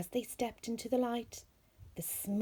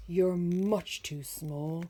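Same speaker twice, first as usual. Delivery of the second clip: growling bear; 6 dB louder, slower than usual. Acted. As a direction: rumble it out as a gruff bear. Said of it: "Bear growled".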